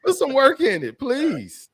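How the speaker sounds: exaggerated pleading; mock begging. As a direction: beg comically, pitch way up. Mock begging to put work in.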